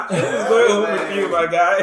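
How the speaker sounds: high, loud words through laughter; laughing hard. Real, not acted. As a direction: crack up while saying it. Joking amid shared laughter.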